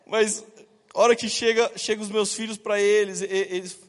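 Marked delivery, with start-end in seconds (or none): none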